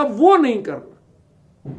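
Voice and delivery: वो नहीं करना drawn out slowly, each word weighted; a mother stressing a warning, slow and deliberate; slow emphatic. Retold or imitated. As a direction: spell out वो नहीं करना slowly, like a firm rule to a daughter. Mother's advice repeated for emphasis.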